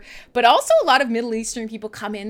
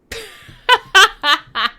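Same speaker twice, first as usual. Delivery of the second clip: high bright laugh bursting out; burst of laughter; 10 semitones higher, 9 dB louder than usual. Real, not acted. Host laughing loudly at her own Nanaimo bar rave.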